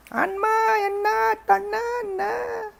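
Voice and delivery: very high, slow keening; hammed-up wail that trails off; mock wailing. Wife grieves over the broken idol, mock wailing.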